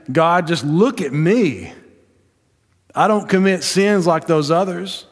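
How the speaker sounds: a touch of a smug Pharisee bragging to God; boastful Pharisee. Acted. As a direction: pray like a smug show-off. Preacher voices the boastful Pharisee.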